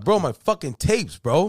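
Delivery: high, loud imitated exasperation; exasperated demand. Brother explains which tapes he means, imitated exasperation.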